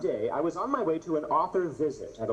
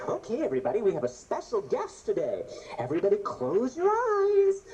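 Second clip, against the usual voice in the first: higher, announcing teacher voice; imitating the teacher; 7 semitones higher than usual. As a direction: build up to a high sing-song teacher call. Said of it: "the teacher's like".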